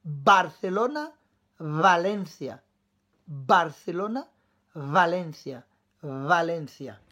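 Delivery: Barcelona, Valencia, careful B and V contrast; B versus V. Shown as a demonstration. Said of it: "Prête bien l'oreille".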